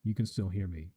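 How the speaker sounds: quiet mic check. Shown as a demonstration. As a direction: drop your voice to show the compressor. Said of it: "If I talk quieter".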